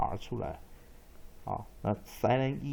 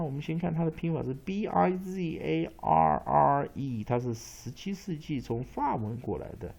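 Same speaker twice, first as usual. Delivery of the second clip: ordinary delivery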